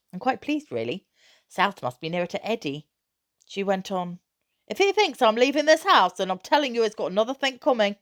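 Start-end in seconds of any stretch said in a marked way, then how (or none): 4.7-8.0 high, loud exasperated rant; mother ranting to son about his father's plan; indignant mother. A narrator's reading. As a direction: vent at the husband's foolishness, higher and louder. Mother defiant about leaving.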